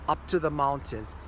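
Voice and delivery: usual speaking voice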